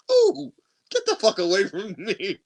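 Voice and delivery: high-pitched mock outrage; exasperated dismissal. Sending his ex away at the end, high exasperation.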